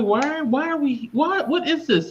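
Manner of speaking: high, slow exasperated question. Retold or imitated. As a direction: whine at the boring book. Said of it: "I'm like".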